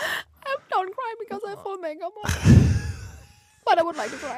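teary, laughing through tears; choked up, half laughing; crying. Real, not acted. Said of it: "weinst du jetzt?". (weinerlich lachend)

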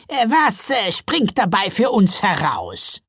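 high, hesitant, loud troll voice; bargaining troll. A narrator's reading. Troll asking what they get out of it, hesitant. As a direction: hem and haw, then ask your share.

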